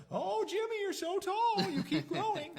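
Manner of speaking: high, sweet doting grandmother voice; grandma voice. Retold or imitated. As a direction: gush like a proud grandma. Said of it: "that was a good grandma voice".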